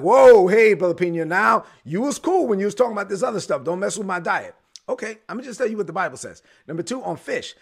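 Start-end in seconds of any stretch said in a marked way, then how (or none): 0.0-4.5 a bacon lover crying foul at the preacher; protesting churchgoer. Retold or imitated. Churchgoer objects to the preacher, loud mock protest. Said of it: "people are like".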